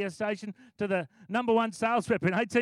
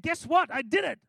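excited news; 7 semitones higher, 3 dB louder than usual. Retold or imitated. Ashley announcing he prayed.

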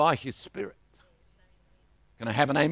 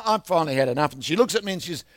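ordinary talk

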